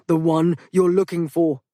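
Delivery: firm insisting voice; insistent. Acted. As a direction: press the point. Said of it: "Theodore insisted".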